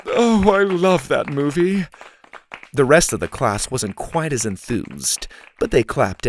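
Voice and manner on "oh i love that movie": high, slow, loud swoon; teacher overcome with admiration; moved gushing. A narrator's reading. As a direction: gush slowly, as if tearful. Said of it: "wiping a tear from her eye".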